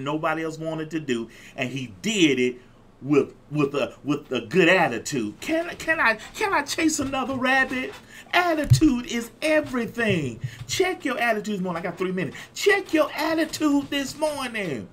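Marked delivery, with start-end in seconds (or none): 5.4-14.9 high, stretched-out preacher's proclamation; preaching. Real, not acted. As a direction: preach it high, drawing out key phrases. Preaches at full force.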